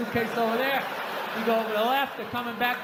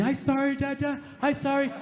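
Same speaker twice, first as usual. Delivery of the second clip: little daughter; 4 semitones higher than usual. Pretend. Child voiced apologizing to dad.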